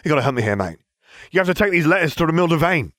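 high, eager pleading; urgent cry. A narrator's reading. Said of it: "Ron cried".

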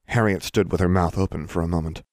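ordinary delivery, nothing marked

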